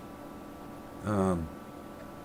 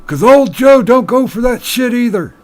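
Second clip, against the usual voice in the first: put-on old bartender; 11 semitones higher, 11 dB louder than usual. Joke punchline, bartender.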